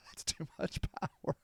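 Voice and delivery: very soft, breath-only rasp; hushed, airy strain; voiceless wheeze. Acted. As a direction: squeeze the words out with almost no voice. Wheezes the line out after laughing.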